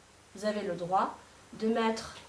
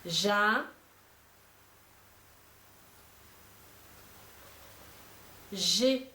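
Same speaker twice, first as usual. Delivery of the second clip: each syllable said slowly and clearly on its own; dictation; 3 dB quieter, much slower than usual. Shown as a demonstration. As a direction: spell it out for pupils to write. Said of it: "Vous allez écrire".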